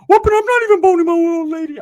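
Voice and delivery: very high, loud cartoonish squeak; squeaky Ricky voice. Ricky squealing back at his teasing cousin. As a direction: squeal it out like Mickey Mouse.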